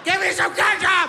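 high, loud burnt-mouth yell; shout for ketchup. Acted. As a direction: scream it like your mouth is on fire.

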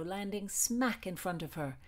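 usual speaking voice

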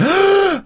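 sharp high gasp of fright; shocked gasp. Retold or imitated. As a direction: gasp like the lift just dropped. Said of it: "everybody went".

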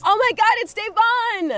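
squealing with delight; excited. Acted. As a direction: gush over the arrival. Greeting guest with fake surprise.

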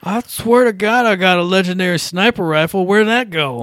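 put-on high, loud drunken protest; confused drunk. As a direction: whine like a drunk gamer. Acting drunk gamers bickering.

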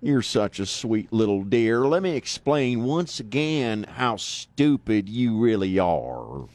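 slow, drawn-out Southern drawl; deep Southern accent. Acted. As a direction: sweetly insult them in a deep Southern accent. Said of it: "a deep Southern accent like".

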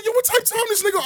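high-pitched incredulous question; outraged disbelief. Retold or imitated. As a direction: play it offended and stunned. Outraged at the insult.

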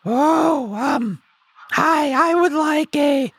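high, sweet timid orderer; high polite customer. Acted.

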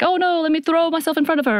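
high, urgent imitation of panic; mock panic. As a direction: act out a frantic rescue cry without shouting. Retelling a panicked rescue.

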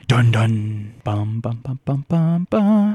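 mock dramatic TV sting; Law and Order sting.

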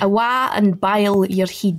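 Scottish accent, slow and drawn out; careful Scottish demo for a learner. Shown as a demonstration. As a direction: spell out "Awa and boil your head" slowly in Scots. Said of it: "do us the best".

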